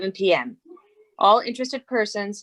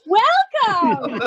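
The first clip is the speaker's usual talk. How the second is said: big game show welcome, high and loud; game show host; 10 semitones higher, 5 dB louder than usual. Pretend. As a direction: belt it like a TV host with a laugh. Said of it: "Can you do this?".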